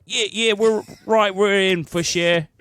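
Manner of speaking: an overeager Australian swearing they're in; Australian accent. Acted. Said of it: "So if I can do an Australian accent".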